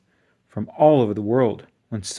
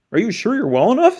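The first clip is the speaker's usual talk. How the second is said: quick, high, louder concern; fussy principal; 10 semitones higher, 6 dB louder, faster than usual. A narrator's reading. Principal worries to the disguised Miss Nelson, quick and high. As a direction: fuss over her like a nervous principal.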